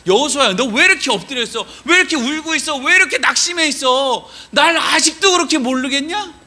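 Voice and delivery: high, insistent, hurt reproach; earnest pleading. Acted. God asking Joshua why he despairs, impassioned reproach. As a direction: plead with a loved one who has lost heart. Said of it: "이렇게 얘기하시는 것 같아요".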